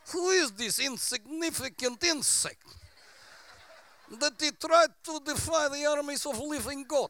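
put-on lightly done Russian accent taunting; a hint of a Russian accent. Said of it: "He probably said in a Russian accent".

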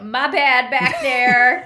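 hammed-up casual apology from Duke; slow loud mock apology. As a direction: stretch it out like a shameless jerk. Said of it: "he's like".

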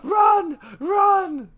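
high, loud mock cry to flee; fleeing fighter shouting at the others to run; panicked shout. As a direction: yell at everyone to run. Panic during the retreat.

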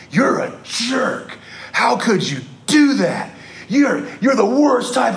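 high-pitched angry driver yelling; retold angry yelling. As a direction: tear into the jogger, voice jumping high. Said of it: "just yelling at me and cussing at me".

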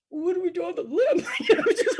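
high, loud question through laughter; laughing, beside herself. Retold or imitated. Overwhelmed woman asking her mentor, laughing high and loud. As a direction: ask it half-laughing, half-sobbing. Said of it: "like, beside myself".

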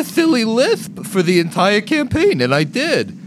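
high, slow voice with "s" turned to "th" in "Thilly"; silly lisp. Shown as a demonstration. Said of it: "to talk with a Thilly lisp".

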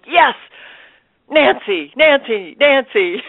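thrilled shout of yes; excited. Retold or imitated. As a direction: chant the name in delight. Overjoyed at getting the part.

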